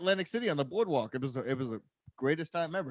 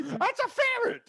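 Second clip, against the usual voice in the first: very high, slow granny voice; cartoon old lady; slower than usual. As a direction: squeal it like a confused granny.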